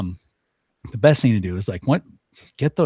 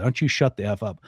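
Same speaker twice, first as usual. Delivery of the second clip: ordinary talk, nothing marked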